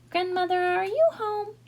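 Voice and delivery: put-on high, girlish call. A narrator's reading. Little Red stepping inside Grandmother's house, calling out. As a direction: sing out high to someone in another room.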